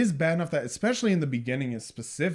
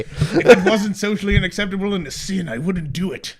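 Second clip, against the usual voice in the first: lightly put-on voice, loud start dropping off; chauvinist character, mocked; 4 semitones higher, 8 dB louder than usual. Co-host jokingly voicing the film's misogynist, mock-reluctant. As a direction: say it like a begrudging chauvinist.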